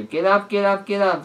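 high, loud chant to rouse a sleeper; urgent wake-up call. Acted. Drake wakes Jay by jumping.

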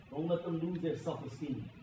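ordinary talk, nothing marked

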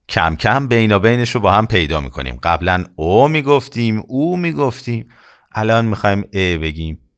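ordinary talk, nothing marked

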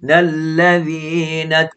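นัลลซีนัต slowed right down, the doubled ล held; slow, stressed shadda. Shown as a demonstration. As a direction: slowly hold the shadda. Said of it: "บุลลามีตัชดีดอยู่". (อ่านช้าๆ เน้นตัชดีด)

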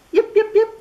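very high, far yipping fox; fox calling. Acted. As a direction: yip out like a little fox calling. Fox answers duck.

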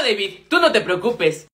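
ordinary delivery

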